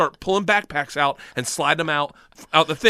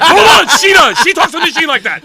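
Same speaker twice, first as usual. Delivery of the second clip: high, loud comic protest; frantic objection; 9 semitones higher, 11 dB louder than usual. Retold or imitated. Student correcting gangster about his teacher, frantic. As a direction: cut in loudly, high-pitched.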